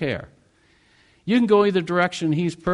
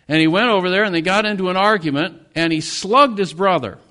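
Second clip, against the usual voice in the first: louder as the fight comes; rising to the punch, louder; building intensity; 4 semitones higher, 6 dB louder than usual. Real, not acted. Preacher telling of the brothers' fight, louder.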